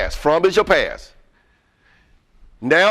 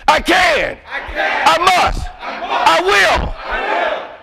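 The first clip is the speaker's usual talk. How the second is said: rallying shout; 12 semitones higher, much slower than usual. Shown as a demonstration. Speaker shouts the full chant.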